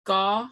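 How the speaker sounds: ga with a plain G; regular G. Shown as a demonstration. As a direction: say ga with an ordinary G. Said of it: "We have a regular G".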